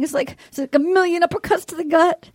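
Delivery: ordinary talk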